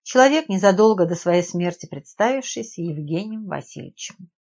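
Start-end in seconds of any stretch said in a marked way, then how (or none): none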